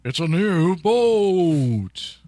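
slow, drawn-out prize reveal; booming prize announcer. Pretend. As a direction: stretch it out like a game show host. Mock game show prize call.

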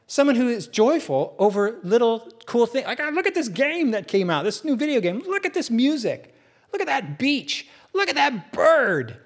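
high, delighted gushing over little things; joyful geek. Pretend. A geek thrilled by a game, music, beach and bird, high delighted gushing. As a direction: gush like a geek seeing cool stuff. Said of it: "do you know what I mean by a geek".

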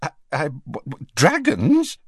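stammering shock, rising high on dragons; spluttering. A narrator's reading. Prime Minister spluttering at news of dragons. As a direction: trip over the words in disbelief. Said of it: "spluttered the Prime Minister".

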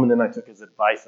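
normal talking voice

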